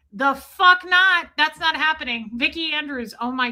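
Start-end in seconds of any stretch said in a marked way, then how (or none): 0.1-2.3 higher, louder, slow put-down; mock shout. Aaron shutting down Marilyn's weekend plans, mock yell. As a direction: drag out the refusal in a mock yell. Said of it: "Aaron was like".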